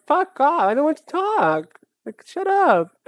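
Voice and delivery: shrieking mock rant at the pushy therapist; mock outburst. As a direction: squeal it out like a cartoon tantrum. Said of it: "I'm like".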